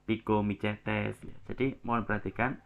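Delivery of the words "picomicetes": picomicetes said slowly with C as CH; drawn-out wrong demo of picomicetes, CH instead of S; mistaken CH reading. Shown as a demonstration. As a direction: say picomicetes as "pi-ko-mi-CHE-tes". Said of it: "Bukan eumicetes".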